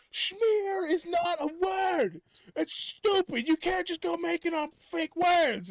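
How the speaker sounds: high, loud, hammed-up shriek of outrage; mock furious yelling. Yelling at family in a fake argument, high mock shriek. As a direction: scream it like a fake tantrum. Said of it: "I was just yelling".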